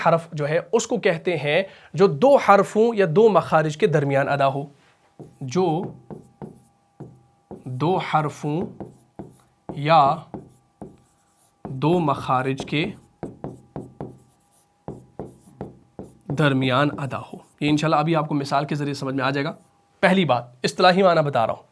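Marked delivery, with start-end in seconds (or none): 5.4-17.4 very slow and soft, long pauses between phrases; teacher dictating, slow and soft; slow dictation. Shown as a demonstration. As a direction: dictate it word by word so students can write. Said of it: "same definition repeated".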